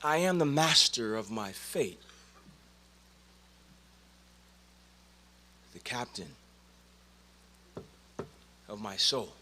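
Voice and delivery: low, soft recitation with long pauses; solemn poem ending. Acted. Speaker ending a poem to his brothers, low solemn recitation. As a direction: close the poem hushed and low, letting each phrase hang.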